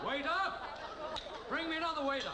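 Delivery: loud, high call across the room. Acted. Bond demanding another waiter, calling out loud. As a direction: shout for the waiter.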